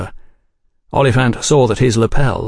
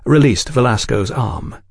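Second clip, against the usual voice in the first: ordinary delivery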